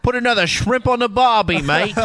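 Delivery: a jokey Aussie impression, high and bright; Australian accent. Mocking the caller on air with an Australian accent. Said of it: "he's from Australia".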